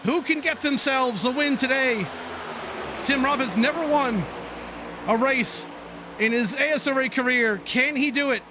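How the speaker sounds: brisk race call building toward the finish; racing commentator calling the last laps; live play-by-play. Acted. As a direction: call the pack as it jostles for position.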